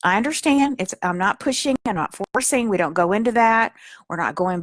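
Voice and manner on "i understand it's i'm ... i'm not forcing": high, gentle coaxing to your inner self; tender, higher reassuring voice; soothing reassurance. Shown as a demonstration. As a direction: soothe a frightened child part. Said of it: "You say".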